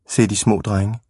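plain reading voice

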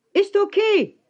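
very high shouted reply; zurückgeschrien. A narrator's reading. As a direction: yell it back up at him. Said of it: "schrie ich zurück". (mit ganz hoher Stimme zurückschreien)

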